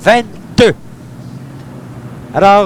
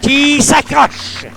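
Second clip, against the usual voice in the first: excited; 10 semitones higher than usual. Real, not acted. Birdie call, excited commentary.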